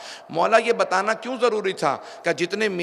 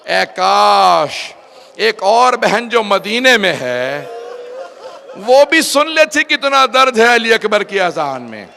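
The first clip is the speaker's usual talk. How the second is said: retold tearful lament; 2 semitones higher, 6 dB louder than usual. Sister grieving at Ali Akbar's adhan, loud and tearful. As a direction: lament loudly, stretching the sighed wish. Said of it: "बहन ने रो के कहा".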